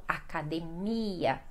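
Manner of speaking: "academia" as a-ca-de-MI-a, stress on MI; Portuguese pronunciation. Shown as a demonstration. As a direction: draw out MI in "academia". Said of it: "mais forte fica no mia".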